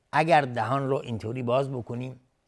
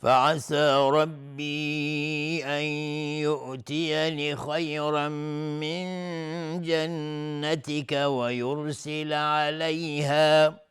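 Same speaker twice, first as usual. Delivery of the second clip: slow, higher chanted tajweed recitation; Quran recitation; 5 semitones higher, 4 dB louder, much slower than usual. Shown as a demonstration. Teacher reciting verse forty, slow high melodic Quran recitation. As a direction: sustain the long vowels and nasals melodically.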